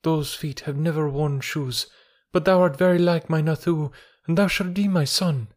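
lightly voiced grieving mother, gentle; motherly village woman. A narrator's reading. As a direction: speak to him as the son she lost. Messua's tender speech to Mowgli.

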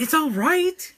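high-pitched, so-so reaction; grudging verdict. Retold or imitated. Bored viewer giving a verdict on the Jungle Book, grudging. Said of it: "because I'm like".

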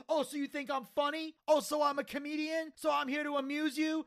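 high, fast indignant taunt; mocking indignation. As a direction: twist his words, offended. Taz twisting Joey's words.

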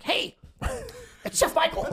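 very high, slow put-on voice; mock the unwelcome caller in a drawn-out high voice; high-pitched comic impersonation, broken by a laugh; imitating the chef.

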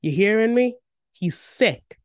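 exasperated, emphatic insistence; insistent exclamation. A narrator's reading. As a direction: press the point at her, high and loud. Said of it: "I, I exclaim".

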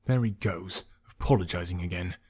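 soft, low aside; inner thought. A narrator's reading. Archer privately noting another apology, thinking quietly. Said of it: "Malik thought".

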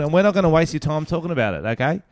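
loud sharp voice; yelling. Real, not acted. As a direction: raise your voice to pump the room. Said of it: "Why are you yelling?".

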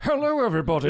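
loud, high put-on greeting voice; Ben Allen. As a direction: belt it out as an over-the-top actor's line reading. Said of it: "how Ben Allen's gonna be doing his lines".

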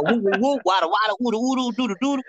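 very high, playful whooping scat; New York street patter. Shown as a demonstration. Reader demonstrates New York talk to her guy, playful street patter. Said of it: "That's how we do in New York".

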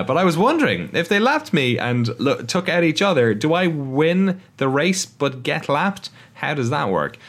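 slipping into a mock Cockney accent. Host voicing Ricciardo slipping into mock Cockney accent. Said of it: "Once I go into Cockney".

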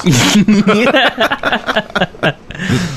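cackling witch laugh. Real, not acted. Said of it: "that gets a witch laugh right there".

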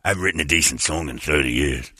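low, slack aging rocker voice. Acted. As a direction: sink it deep and let the words slump. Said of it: "do we, [name]?".

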